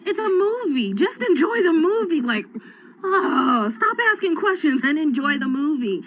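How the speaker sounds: put-on a fed-up mother brushing off her child's questions; exasperated mom. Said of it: "And I'm like".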